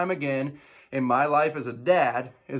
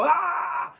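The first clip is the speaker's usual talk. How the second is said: very high frustrated yell; fed-up dad yelling at kids; frustrated outburst; 13 semitones higher, 3 dB louder than usual. Retold or imitated. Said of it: "I lose my cool and I get frustrated and I go".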